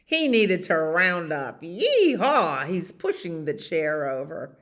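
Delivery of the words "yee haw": high, loud yee-haw; gleeful rodeo cry; cowboy whoop. Acted. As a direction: whoop it like rounding up cattle. Dog whoops while rounding up.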